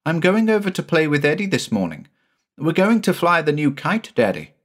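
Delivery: put-on a boy bubbling about his plans; high quick child's voice; excited little boy.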